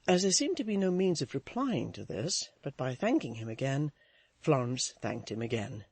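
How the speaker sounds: ordinary talk, nothing marked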